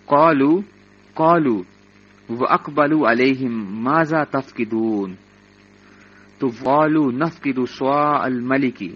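lightly intoned verse recitation; measured tafsir recitation; Quran recitation. Acted. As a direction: chant the verse gently as in class. Recites Quran verses between translations.

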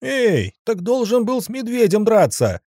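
high, loud offended yell; offended heckler. Acted. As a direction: shout it from the stands, aggrieved. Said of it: "раздался обиженный голос из толпы".

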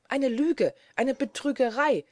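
high, loud accusation; outraged cry. A narrator's reading. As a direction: cry out in indignant protest. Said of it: "rief die Französin noch einmal".

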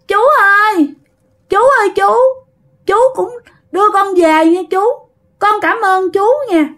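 put-on high, little-kid voice pleading politely; small child's voice. Child spirit begs the driver for a ride home, small child's voice. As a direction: pitch it way up like a toddler asking a favour. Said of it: "bắt đầu đổi ra giọng nít".

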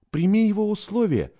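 fast, high urgent plea; quick urgent. Acted. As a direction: blurt it out quickly. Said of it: "быстро проговорила она".

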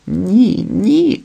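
high-pitched tearful denial; crying out in tears. A narrator's reading. Wife denying husband's accusation, crying out in tears. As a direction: cry out a high, pleading denial. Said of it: "кричала і плакала".

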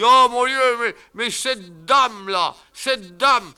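put-on high loud slow old man's cry; excited deaf old man. Deaf uncle recognizing the hat, crying out. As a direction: stammer out the discovery.

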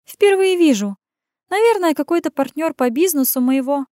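high, scoffing female voice; snorting dismissal. Acted. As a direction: scoff it off lightly. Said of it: "фыркнула".

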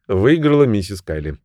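usual speaking voice

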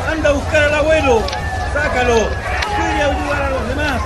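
frantic, calling María out of the flames; panicked shouting. Acted. House on fire, calling for help. (gritos desesperados de pánico)

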